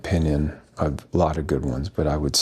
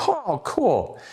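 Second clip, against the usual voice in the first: much higher, drawn-in delight; retold pleased reaction; 14 semitones higher than usual. Recalling spotting the miraculous medal, pleased reaction. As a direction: lift the pitch high, acting pleasantly impressed.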